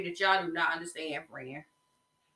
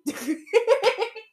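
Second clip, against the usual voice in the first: high laughter; 10 semitones higher, 3 dB louder than usual. Real, not acted. Podcaster laughing at her own reaction, high laugh.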